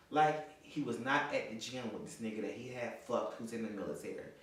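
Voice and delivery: normal talking voice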